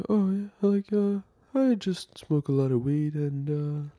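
high, put-on stoner comedian voice; Adam Sandler. Podcaster mimics the actor's weed-smoking character, high put-on voice. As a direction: do the goofy star, high and loose. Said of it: "He talks like this".

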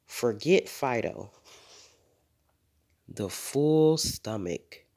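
ordinary delivery

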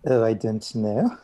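normal talking voice

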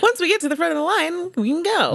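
high, fast sing-song hope; hopeful mock chirp. Friends in the line, naive and high. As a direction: chirp it up brightly, high and quick. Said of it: "we're like".

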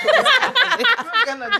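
slow high laughing protest. Real, not acted. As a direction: laugh it out slowly. Laughing after silly remark.

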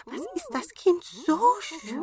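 hohe Zwergenstimme, bewundernd; hoch und verzückt fragen; staunende Zwerge. A narrator's reading. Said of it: "riefen sie". (hohe Zwergenstimme)

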